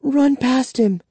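plain reading voice

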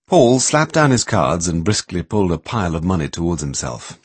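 usual speaking voice

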